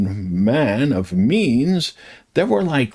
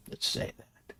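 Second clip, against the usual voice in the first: very soft, whispered aside; hushed aside; 17 dB quieter than usual. Real, not acted. Lecturer covering a delicate word, hushed aside. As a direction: drop to a whisper.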